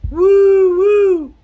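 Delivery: high, loud double "woo"; answering cave call. Acted. Reply coming out of the cave, high loud hoot. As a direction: hoot back in long high tones. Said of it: "he hears a".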